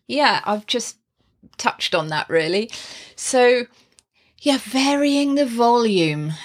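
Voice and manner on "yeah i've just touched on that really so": normal talking voice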